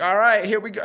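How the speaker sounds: high, loud imitation of an Australian; Australian accent. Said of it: "Like, in his accent".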